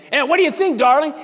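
high, loud sneering taunt; mocking taunt. Coworker mocking the preacher.

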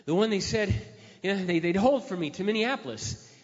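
loud plea to the counter lady; insistent plea. Retold or imitated. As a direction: push hard, desperate traveler. Pleading at counter, rising.

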